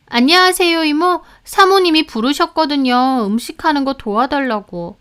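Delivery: lightly voiced maid greeting; sweet maid. A narrator's reading. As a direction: sweeten it for the in-laws.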